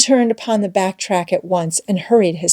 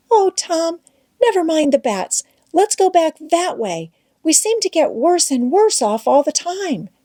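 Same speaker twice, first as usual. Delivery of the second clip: higher Becky, holding back tears; pleading Becky; 9 semitones higher than usual. A narrator's reading. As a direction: beg Tom to turn back, pitched up.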